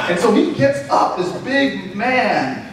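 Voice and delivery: ordinary talk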